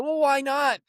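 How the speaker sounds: high pushy salesman push-back; pushy why not. Retold or imitated. Solar salesman pushing a reluctant neighbor, high and pushy. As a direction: press him like a pushy salesman. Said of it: "he was very pushy".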